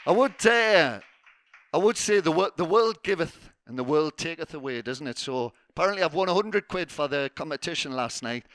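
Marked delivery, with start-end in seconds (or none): none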